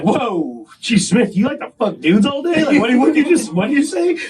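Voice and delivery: feigned surprise building up; mock shock. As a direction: pretend you're scandalized, rising into squeaky outrage. Mock shock at chief's slip.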